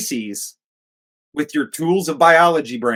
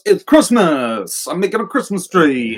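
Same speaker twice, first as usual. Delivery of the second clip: hammed-up Texas slash British accent, pitched up; 5 semitones higher, 4 dB louder than usual. Mocking Idris Elba's line in the movie, Texas slash British accent. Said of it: "in his Texas slash British accent".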